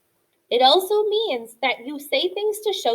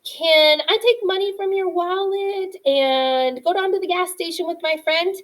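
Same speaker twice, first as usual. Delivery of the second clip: put-on kid asking dad; 7 semitones higher, 5 dB louder than usual. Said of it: "I used to ask my dad things like".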